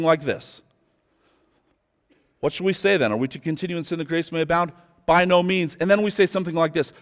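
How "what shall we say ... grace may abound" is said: fast, softer, offhand rattling through; preacher mimics how most people skim the verse, rushed; rushed misreading. Shown as a demonstration. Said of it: "the way most of us read".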